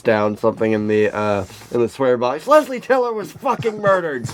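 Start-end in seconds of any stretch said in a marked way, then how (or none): 2.5-4.3 shouted quote. Retold or imitated. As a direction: blurt it out in a high voice. Quoting swear box moment.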